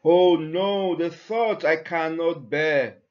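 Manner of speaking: ordinary talk, nothing marked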